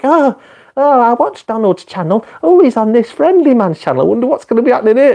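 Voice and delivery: put-on high, excited child voice; little kid voice. Streamer imitates kids finding his channel, high child voice. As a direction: play an eager little kid fan. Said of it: "little kids that go like".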